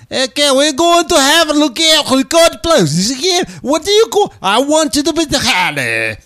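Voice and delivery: put-on high, loud indecipherable accented gibberish; Borat. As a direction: garble it into foreign-sounding nonsense. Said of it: "I got more and more indecipherable".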